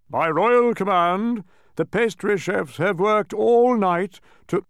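slow, loud royal proclamation; pompous king. A narrator's reading. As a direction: proclaim it grandly, stretching the key words. King restarts his announcement.

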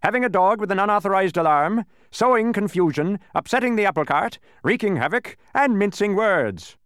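high, loud list of charges; officious charge-reading. A narrator's reading. Policeman listing Milo's crimes, officious proclamation. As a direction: proclaim each crime, slowing on the last.

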